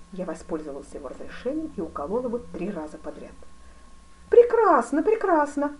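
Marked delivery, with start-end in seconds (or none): 4.3-5.8 loud, high cry of approval; exclaiming. A narrator's reading. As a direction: exclaim it excitedly, voice soaring. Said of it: "воскликнул он". (громко восклицая)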